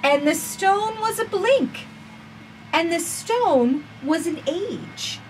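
gentle, measured storybook reading; reading to children. Acted. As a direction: read it slowly to little listeners. Reads picture book aloud.

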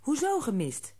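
ask, baffled; puzzled. A narrator's reading. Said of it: "Mama Haas keek verbaasd". (verbaasde moeder, hoog)

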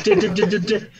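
repeated English 't' tongue taps; English T demo. Shown as a demonstration. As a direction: tap out a string of English t sounds. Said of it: "se pone la lengua". (repetición de la 't' inglesa con la lengua)

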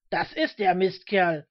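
loud, slow emphatic cry; exclaiming. A narrator's reading. Husband spotting the culprit on television, loud exclamation. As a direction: call it out on recognising him. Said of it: "rief er der verdutzten Misses Harrison zu".

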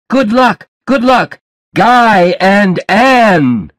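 high cheers building to a long drawn-out yell. A narrator's reading. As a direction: swell into a big, stretched crowd cheer. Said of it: "The noise got louder and louder".

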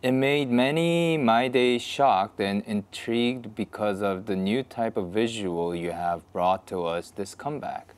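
very slow, deliberate English; slow careful English reading. Acted. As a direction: read each word out slowly. Reads fan question in English slowly.

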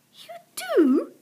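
high, loud, hopeful disbelief; astonished cry. A narrator's reading. Missus Silver hearing he knows how, astonished cry. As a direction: exclaim in delighted surprise. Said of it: "she cried".

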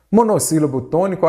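ordinary talk

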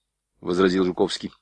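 ordinary delivery, nothing marked

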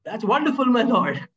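bright, upbeat reply to the angry king; cheerful minister. Retold or imitated. Minister cheerfully answering the banished king, mildly voiced. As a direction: answer cheerfully as if nothing's wrong. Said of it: "So the minister says".

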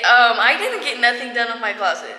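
ordinary talk

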